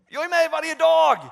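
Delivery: loud, high call from above, slowing at the end; God calling out. Retold or imitated. God calling to the disciples from the sky, loud and high. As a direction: ring it out like a voice from heaven. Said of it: "höra Guds röst bara ropa".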